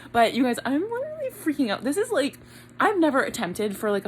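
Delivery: ordinary talk, nothing marked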